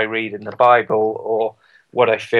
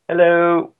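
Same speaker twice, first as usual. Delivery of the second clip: high-pitched, geeky British cheeriness; typical British geek; 7 semitones higher than usual. Retold or imitated. Mocking his own game shout-out, typical British geek. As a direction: send yourself up as a hopelessly uncool Brit. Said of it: "the typical British".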